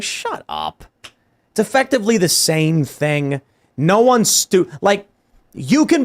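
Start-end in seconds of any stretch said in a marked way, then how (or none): 0.0-0.8 low, soft, flat "Shut up"; curt dismissal. Retold or imitated. Answering leftists with a low curt dismissal. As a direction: brush them off in a dropped voice. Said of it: "I'm like".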